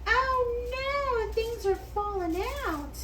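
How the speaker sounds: sudden high-pitched dismay; startled as it spills; surprised. Real, not acted. Food spilling while folding.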